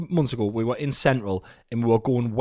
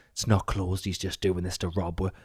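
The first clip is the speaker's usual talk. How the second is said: low, softer readout of a suspicious text; retold text message; 7 semitones lower, 6 dB quieter than usual. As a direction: read it as a mock confidential warning. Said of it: "it's like".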